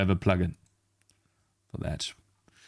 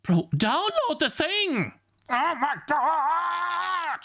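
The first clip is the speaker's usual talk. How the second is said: much higher, slow, drawn-out plea; exasperated cry; much slower than usual. Real, not acted. Streamer wailing at the program to download. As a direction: wail at the computer in disbelief.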